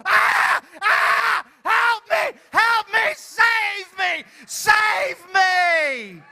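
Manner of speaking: shrieking very high and loud, "Help me!"; terrified screaming. Shown as a demonstration. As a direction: scream for help in mock terror. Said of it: "and you go".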